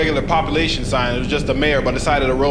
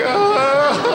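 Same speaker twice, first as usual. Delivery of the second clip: high, drawn-out comic wail; exaggerated kiddie sob; mock child crying. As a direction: bawl like a frightened schoolkid. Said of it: "One kid started crying".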